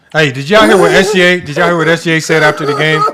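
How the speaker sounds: laughing. Real, not acted. Excitedly sharing a funny postgame moment.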